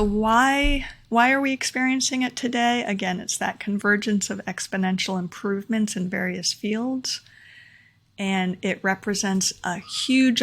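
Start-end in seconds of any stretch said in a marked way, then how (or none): none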